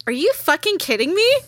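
mock-indignant, rising at the end; retold disbelief. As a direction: imitate your own bitchy reaction. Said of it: "I'm such a fucking bitch".

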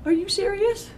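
disbelief. Retold or imitated. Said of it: "I was like".

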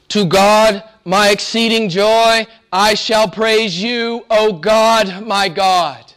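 proclaimed psalm. Acted. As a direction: ring the verse out to the room. Psalm repeated aloud after whisper.

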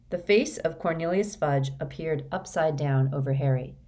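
ordinary delivery

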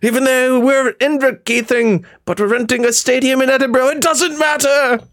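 high-pitched hammy English match commentator; bad English commentary. Streamer calling his team's playoff run, bad English commentary. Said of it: "I broke into a bad English commentary".

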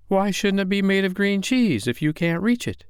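high-pitched, insistent mouse voice; protesting little mouse. Acted. Willie argues with Miss Wren about the moon, protesting.

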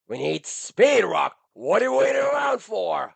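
very high, loud coach-style shouting; hype yell. Acted. Dad hyping himself like a Rocky training montage, shouting high. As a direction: fire up a fighter in training.

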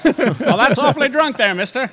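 high, laughing hammed-up period voice; laughing old-timey reply. Cohost answering the boiled owl line, laughing. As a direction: chuckle through it like a saloon regular.

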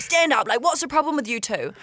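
high-pitched exasperated teacher, imitated; mock teacher scolding. Chemistry teacher to two laughing pupils, mock exasperated scolding.

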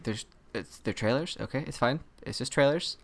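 very soft, hesitant murmur; hushed retold muttering; muttered self-reassurance. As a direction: talk yourself down under your breath. Retold muttering to self during trailers.

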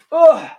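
high, loud grunt of effort; straining grunt. Real, not acted. Lifting heavy trophy belt.

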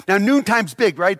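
ordinary talk

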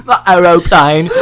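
loud, hammed-up British rock singer. Playing a rocker covering Aeroplane, loud mock British singer. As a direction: belt it out in a mock British accent.

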